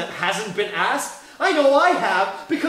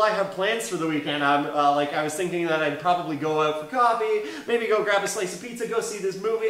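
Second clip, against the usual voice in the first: low, casual imitation of a friend; somebody talking plans; 7 semitones lower than usual. Imitating somebody talking about weekend plans, low-voiced. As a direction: drop the pitch and chat offhand as someone else. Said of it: "if somebody's talking about".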